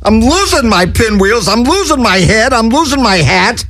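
squeaky high voice, frantic and cracking up; frantic cartoon voice. The Chickster cracking up before the folks, squeaky high. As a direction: play a hysterical little cartoon bird.

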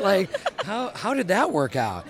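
ordinary talk, nothing marked

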